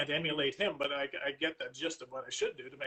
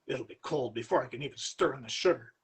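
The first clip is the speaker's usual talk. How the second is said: mild growl; 2 semitones lower, 3 dB louder than usual. Acted. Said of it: "Mister Markham growled".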